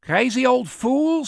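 high-pitched heckle; called out. A narrator's reading. Onlooker heckling the preaching old man, called out. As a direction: call it across a crowd. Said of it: "someone called out".

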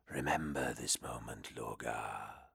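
low, breathy whispering demon. Acted. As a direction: hiss it like a hidden demon. Demon speaks.

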